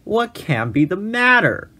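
cried out. A narrator's reading. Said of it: "cried the keeper".

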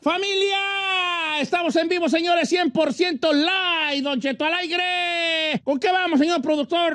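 loud, high on-air announcer; big live radio opening; radio host. Acted.

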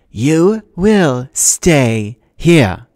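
very slow, high, drawn-out order; icy command. Acted. Mrs Dodds orders Grover, slow icy command. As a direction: press each word like a threat.

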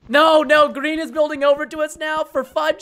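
alarmed protest. Real, not acted. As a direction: burst out loudly to warn the team. Alarm as enemy builds over.

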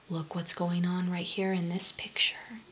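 hushed, low whisper after a gasp; whispered aside. Real, not acted. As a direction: whisper to draw listeners into the picture. Hushed aside about the picture.

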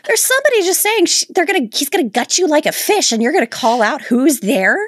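high incredulous rant; mock her in disbelief. Real, not acted. Exasperated at movie heroine.

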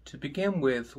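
soft, calm meditation guide; guided meditation. Acted. Teacher guiding students into meditation, soft and calm. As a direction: settle them in gently.

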